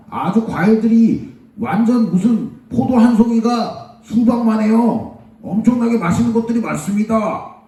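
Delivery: high, declaiming scouts' report; reporting spies. Acted. Spies reporting back on a fertile land, high and emphatic. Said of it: "열 명의 정탐꾼들이 이렇게 얘기를 하는 거예요".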